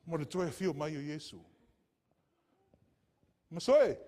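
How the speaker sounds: loud, high calls to be ready; fiery preaching. Acted. Preacher urging the congregation to be ready, loud and high. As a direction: call out to the congregation with urgent force.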